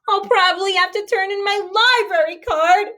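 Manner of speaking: a hint of a sob; sobbing. A narrator's reading. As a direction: Stella despairing while running. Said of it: "sobbed Stella".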